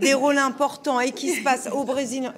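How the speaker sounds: talking through laughter; bright laugh in the voice. Real, not acted. Laughing while proposing two films. (en riant)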